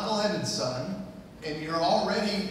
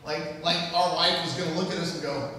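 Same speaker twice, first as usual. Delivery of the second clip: ordinary talk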